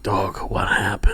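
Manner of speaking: soft, breathy whispered concern; hushed worried question. Retold or imitated. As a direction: ask it low, almost under the breath. Friend calling after bad set.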